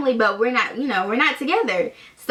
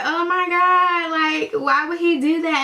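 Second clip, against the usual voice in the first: mock panic; 6 semitones higher than usual. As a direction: whimper it like a dramatic meltdown. Panicking as ex's dad appears.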